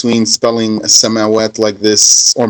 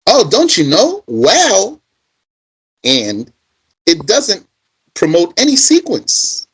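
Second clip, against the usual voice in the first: higher, mocking voice of a smug know-it-all; 6 semitones higher, slower than usual. Speaker mimics a weak scholar lecturing you, higher, mocking. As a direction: sneer it like someone weak in the language. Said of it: "will say to you".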